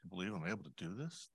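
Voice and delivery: soft, quick, gently rising question; gentle question. Acted. Jesus asking his captive audience, gently. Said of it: "As I read this".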